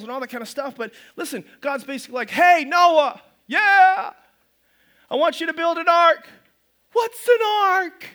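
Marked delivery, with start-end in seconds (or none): 2.3-6.3 booming God. Acted. God voiced calling Noah. 6.9-8.1 high, loud and slow baffled voice; puzzled Noah. Acted.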